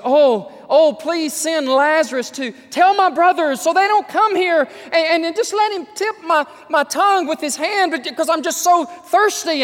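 high, loud begging cry; mock pleading from hell, high and loud; pleading rich man. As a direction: beg for a drop of water. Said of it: "he's crying out for mercy".

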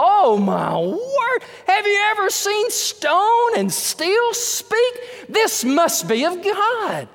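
high, slow, wonderstruck exclamation; stretched out, amazed and in awe; awed crowd. Pretend. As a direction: gasp it out like people seeing a miracle. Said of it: "the people of the world are gonna go".

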